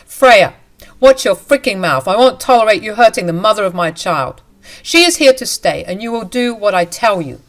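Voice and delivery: loud, hard furious husband; furious shout. Acted. Husband threatening his wife, loud furious shout. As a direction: bark orders at the wife.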